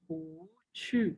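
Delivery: पू छू with पू rising high and slow; second tone pू. Shown as a demonstration. Teacher models पू छू as a second tone. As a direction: lift पू into a second tone. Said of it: "पू को फिर हम सेकंड टोन से पढ़ते".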